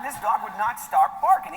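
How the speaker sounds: normal talking voice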